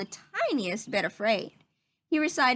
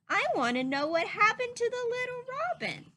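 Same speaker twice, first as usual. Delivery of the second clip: put-on high, bright little boy begging to hear more; a small raccoon child pleading for the story; eager child voice; 10 semitones higher, faster than usual. A narrator's reading. Child asking his mother for the story, pitched high and eager.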